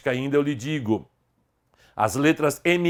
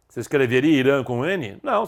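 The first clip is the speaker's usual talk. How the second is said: ordinary talk, nothing marked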